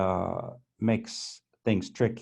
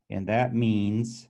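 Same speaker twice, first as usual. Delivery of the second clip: ordinary talk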